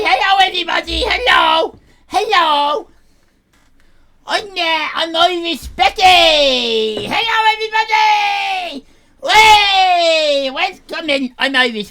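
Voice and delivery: loud, high hammed-up greeting; Irish Becky. Irish Becky greeting her stream viewers loudly. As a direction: belt out hello as a brash teen character.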